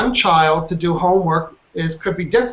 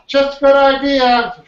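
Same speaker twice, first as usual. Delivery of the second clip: high, loud, slowed-down reply; mock vague partner; 8 semitones higher, 5 dB louder, slower than usual. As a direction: imitate the noncommittal partner, drawn out and high. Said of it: "And they'll say".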